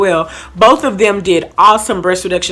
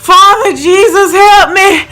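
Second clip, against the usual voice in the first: high, half-laughing cry for help; hammed-up panic, calling on Jesus; mock panicked plea; 10 semitones higher, 5 dB louder, slower than usual. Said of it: "I was like".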